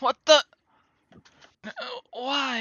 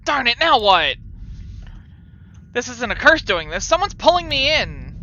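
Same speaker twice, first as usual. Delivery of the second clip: panicked; 7 semitones higher, 6 dB louder than usual. Real, not acted. Grabbed by curse in game.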